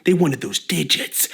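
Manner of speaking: usual speaking voice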